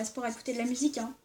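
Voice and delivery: ordinary talk, nothing marked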